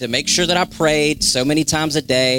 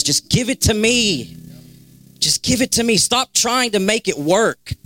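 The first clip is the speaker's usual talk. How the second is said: high, urgent preaching plea; God speaking; 7 semitones higher than usual. Acted. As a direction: voice God's insistent appeal in a raised pitch. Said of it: "And he says".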